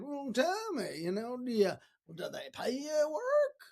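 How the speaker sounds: high-pitched put-on accent; hammed-up folksy questioning; lousy Zig Ziglar. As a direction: do a bad impression of a motivational speaker. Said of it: "That was a lousy impersonation of Zig Ziglar".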